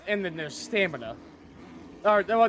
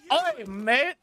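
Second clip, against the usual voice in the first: mock British taunt; 7 semitones higher, 3 dB louder than usual. Taunting opponents in game.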